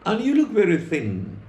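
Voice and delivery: lifts the voice high for the dog's retort; a lightly voiced, high house dog answering the wolf. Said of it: "House dog".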